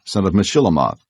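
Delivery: ordinary delivery, nothing marked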